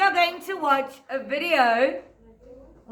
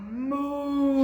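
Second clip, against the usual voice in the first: cow moo; 3 dB quieter than usual. Acted. Imitating a cow.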